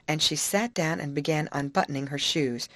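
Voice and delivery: ordinary delivery, nothing marked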